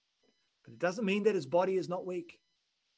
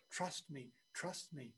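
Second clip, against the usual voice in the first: soft plea; 13 dB quieter, slower than usual. Acted. God's voice, soft repeated plea.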